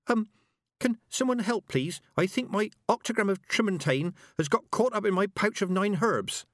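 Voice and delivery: put-on high, small girl voice bent double; a small voice from inside a tangle; tangled girl calling for help; little muffled voice. A narrator's reading. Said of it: "came a little voice".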